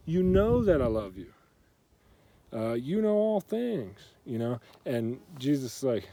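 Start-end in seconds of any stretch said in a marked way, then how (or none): 0.1-4.0 retold upset protest, pitched high and louder. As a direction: protest it, voice climbing high on the key words. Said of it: "Peter gets upset. He's like".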